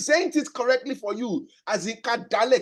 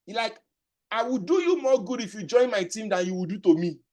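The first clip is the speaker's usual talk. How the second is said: normal talking voice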